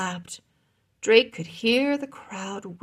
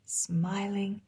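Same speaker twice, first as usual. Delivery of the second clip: plain reading voice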